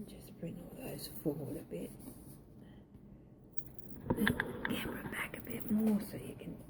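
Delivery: quick whisper off to the side; whispering to whoever holds the camera; whispered aside. Real, not acted. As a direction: murmur an instruction to the helper, off mic.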